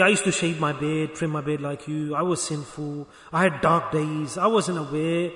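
low, soft admission; humble confession. Acted. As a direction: confide past sins gently, as an equal. Models humble confession to a sinner.

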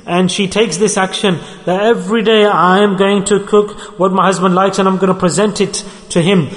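usual speaking voice

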